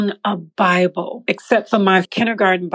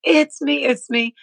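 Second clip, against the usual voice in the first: retold scream; 6 semitones higher than usual. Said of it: "I'm screaming at this man".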